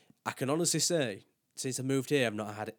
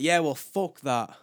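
slow, higher, louder sulky dismissal; mock stubborn brush-off; defiant retort; 6 semitones higher, 9 dB louder, slower than usual. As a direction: drag it out like a sulky kid. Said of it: "And I'm like".

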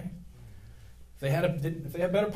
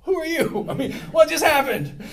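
stunned disbelief; 13 semitones higher, 9 dB louder than usual. Retold or imitated. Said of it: "And I was like".

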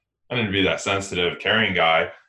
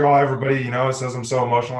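higher, louder mock whine; imitating the client's sister; 6 semitones higher, 5 dB louder, faster than usual. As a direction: put on her plaintive voice, pitched up. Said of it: "She's like".

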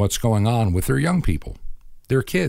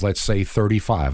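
ordinary talk

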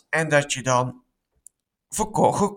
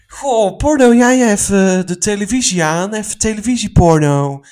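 playful loud; 5 semitones higher, 9 dB louder than usual. Acted. Playful mock announcement.

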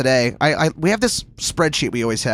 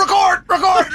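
high shouted call to record; retold shout; 3 dB louder than usual. Bandmate bursting in from the rain, shouting. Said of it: "the door opened and go".

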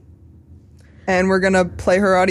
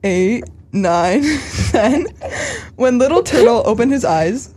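put-on earnest storybook voice for children; solemn read-aloud of a kids' tale; children's story reading; 3 semitones higher than usual. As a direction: read it like to an auditorium of kids.

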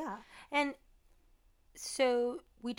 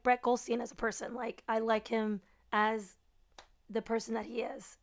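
ordinary delivery

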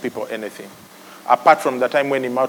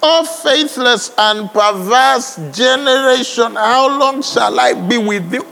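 quoting Jesus; 8 semitones higher than usual. Retold or imitated. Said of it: "what did he tell them".

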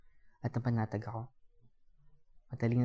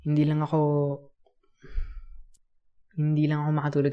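louder, halting cover story; stall, restart, then get the excuse out; awkward, broken-off explanation; hesitant excuse; 3 semitones higher, 5 dB louder than usual. Acted. Hesitant excuse for sleeping there.

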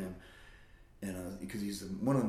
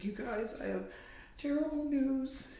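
high, put-on tearful voice; tearful mom. Mom sitting the kids down with news, tearful. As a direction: imitate a crying mother breaking bad news. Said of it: "she's crying. She's like".

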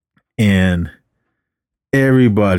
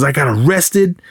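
higher-pitched retold grievance; mock angry yell; 6 semitones higher than usual. Fed-up teacher lists his sacrifices, mock yelling. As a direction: imitate an exasperated teacher, not truly shouting. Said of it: "he was just yelling at all of them".

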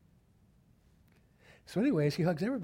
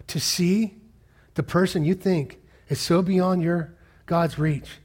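louder, higher, slow emphatic call; preaching climax; 5 semitones higher, 5 dB louder, slower than usual. Acted. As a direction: stretch it out like a rallying cry. Climax of the challenge.

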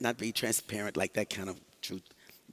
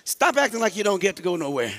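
higher, louder imitation of a scolding mom; mother scolding a child in a store, mock; mom's scolding; 7 semitones higher, 6 dB louder than usual. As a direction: snap at the kid like mom did. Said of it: "did your mom ever say something".

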